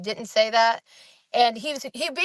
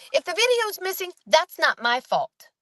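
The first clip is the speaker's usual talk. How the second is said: defensive; 6 semitones higher than usual. Retold or imitated. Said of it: "she got super defensive".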